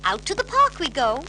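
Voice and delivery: high, loud brisk nanny voice; brisk nanny call. A narrator's reading. Mary Poppins waking the children, brisk and loud. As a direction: rouse them briskly, crisp and high. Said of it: "said Mary Poppins".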